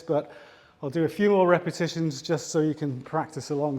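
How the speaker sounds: ordinary talk, nothing marked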